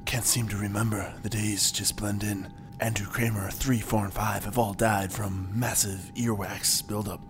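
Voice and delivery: low, hushed log entry; hushed captain's log. Acted. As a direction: murmur the log entry softly.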